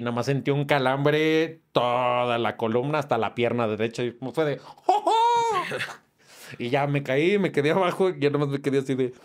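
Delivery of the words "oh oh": high strained cry of pain, half laughing; pained yelp. Retold or imitated. Comedian reenacts crying out when the gun hits his spine. Reacts to pistol hit on spine.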